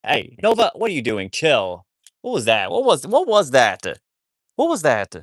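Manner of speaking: high, loud, sharp telling-off; loud what-was-that at the dog; scolding the dog. Real, not acted. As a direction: stop the dog mid-mischief.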